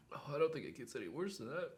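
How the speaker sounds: ordinary talk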